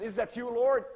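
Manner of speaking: loud, high-pitched disbelief; startled question. Retold or imitated. Preacher retells questioning the voice he heard, startled. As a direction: call up to heaven, taken aback.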